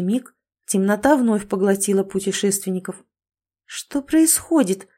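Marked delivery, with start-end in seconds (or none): 3.6-4.9 slow, higher bewildered question. Acted. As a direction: ask it baffled, drawn out. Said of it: "недоуменно спросила Катя".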